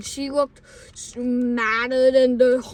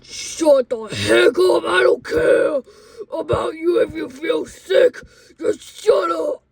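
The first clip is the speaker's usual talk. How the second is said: furious, loud, raised-pitch outburst; mad yell; 5 semitones higher, 7 dB louder than usual. Retold or imitated. Driver snapping at noisy bus kids, mad shouting. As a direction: explode at them, imitating an angry adult. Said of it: "She went from happy to mad".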